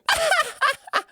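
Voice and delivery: high cackling witch laugh; shrill anime witch cackle, way up high. Shown as a demonstration. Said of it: "that was like an anime witch laugh".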